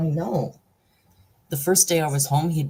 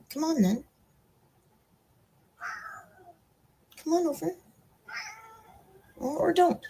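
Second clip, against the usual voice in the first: very high, cooing invitation; coaxing pet talk; 4 dB quieter than usual. Real, not acted. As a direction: coax a pet over in a high little voice. Coaxing the pet to come over.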